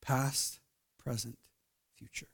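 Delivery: hushed, slow appeal; soft pleading. Acted. Tender appeal in prayerful close.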